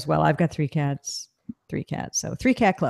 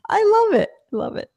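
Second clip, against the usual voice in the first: delighted squeal; 4 dB louder than usual. Real, not acted. Laughing delight at chat.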